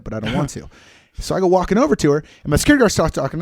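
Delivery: normal talking voice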